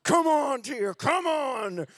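straining to squeeze out a tear; urging himself. Retold or imitated. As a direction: coax the tear out like a coach. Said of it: "I was like".

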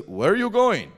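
high, loud questioning stranger; mimicking the stranger's blunt question; imitated local stranger. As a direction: call it out high like a curious local. Retold stranger questions the lost travelers.

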